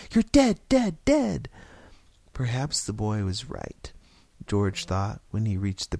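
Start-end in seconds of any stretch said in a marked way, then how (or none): 0.1-1.5 put-on high, loud child's gunfire chant; shrieky kid playing gunfighter; little boy taunting. A narrator's reading. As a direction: shout each word like a shot. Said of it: "snapping the pistol at him and saying".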